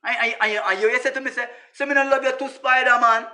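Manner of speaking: normal talking voice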